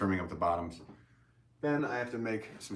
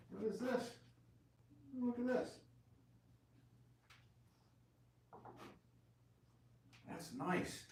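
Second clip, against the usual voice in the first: high, soft surprised murmur; pleasantly surprised, half to himself; 12 dB quieter than usual. Real, not acted. Surprised at wife's bowl.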